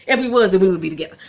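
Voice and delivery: brushing off her own love life with a laugh. Real, not acted. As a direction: laugh it off quickly. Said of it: "laughing aside".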